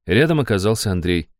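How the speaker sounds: usual speaking voice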